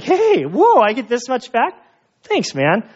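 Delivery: imitating a delighted surprise; mock surprised delight. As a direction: play the pleasantly shocked tourist. Surprised at getting change back.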